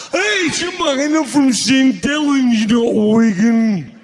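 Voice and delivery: loud, dragging Lancastrian drawl; exaggerated slow Lancastrian, words stretched out. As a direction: imitate Lancastrian like a record at the wrong speed. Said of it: "you're not playing at the right speed".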